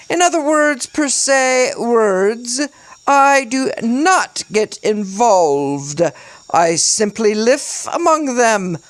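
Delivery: over-the-top British monologue, high and loud; stagey, pompous British, pitched high; forced British accent. A narrator's reading. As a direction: proclaim it in a hammy British accent. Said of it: "His accent was forced and over the top".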